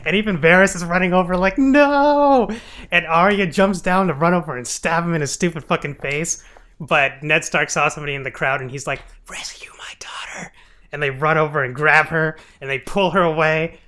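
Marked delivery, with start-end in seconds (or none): 1.6-2.5 high, loud hammed-up no; mock shout, high and loud; mock cry of no. Varys shouting in retelling. 9.3-10.5 unvoiced whisper; hushed whisper, no voice at all; whispered plea. Ned's secret plea retold.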